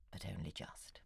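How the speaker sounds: breathy whisper, barely voiced; whispered aside. Writer confiding to his correspondent, whispered aside. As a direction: drop to a rueful whisper for the last words. Rueful aside about marriage.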